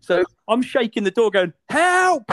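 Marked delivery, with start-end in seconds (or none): 1.7-2.2 high, loud cries for help; shouting for help. Retold or imitated. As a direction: yell help, trapped in a loo. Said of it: "like this, right?".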